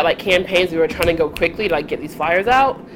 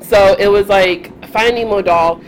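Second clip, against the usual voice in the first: ordinary delivery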